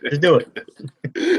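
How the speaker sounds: slow, emphatic pitch to the viewers; drawn-out slogan. Pretend. Host urging viewers to blindly subscribe, slow mock slogan delivery. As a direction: stretch each word slowly like a slogan.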